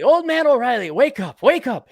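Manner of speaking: high calling wife voice; wife waking him. Acted. Wife waking her hungover husband, high and calling. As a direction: call him awake like a nagging wife.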